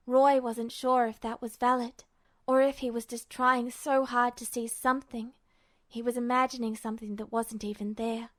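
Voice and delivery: plain reading voice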